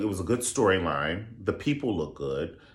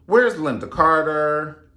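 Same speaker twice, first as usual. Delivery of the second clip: normal talking voice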